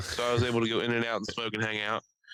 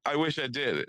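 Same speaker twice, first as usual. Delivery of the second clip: ordinary talk, nothing marked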